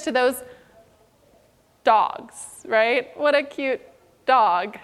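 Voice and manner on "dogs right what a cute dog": ordinary delivery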